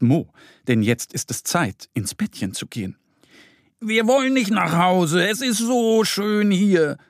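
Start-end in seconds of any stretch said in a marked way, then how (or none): 3.8-7.0 high-pitched little bear protesting. A narrator's reading. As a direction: plead to stay out, voice pitched way up. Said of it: "sagt der kleine Bär". (hohe Stimme eines protestierenden Bärchens)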